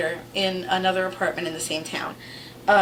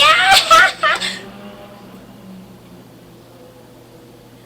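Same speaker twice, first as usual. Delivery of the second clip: loud laugh; 10 dB louder than usual. Real, not acted. Laughing at own story.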